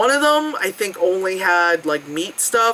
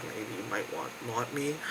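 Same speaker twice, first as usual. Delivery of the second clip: much lower, much softer seductive murmur; vending machine's voice; 10 semitones lower, 18 dB quieter than usual. Retold or imitated. The vending machine luring the tired climber, low soft murmur. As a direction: tempt the listener like a machine. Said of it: "sign of, like".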